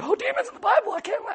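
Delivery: very high squeaky put-on voice; exaggerated high-pitched dismay; mock freak-out. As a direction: squeal it like an overwhelmed listener. Mimics overwhelmed listener.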